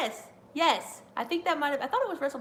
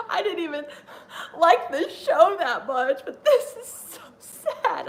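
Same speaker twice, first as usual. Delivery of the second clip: imitating teary brother; 7 semitones higher, 7 dB louder, slower than usual. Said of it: "and he's like".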